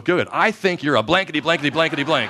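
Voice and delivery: loud, high gleeful insult; defiant golfer. Retold or imitated. Golfer Simon gleefully insulting the tour official, loud and high. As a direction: triumphantly deliver the punchline insult. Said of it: "He goes".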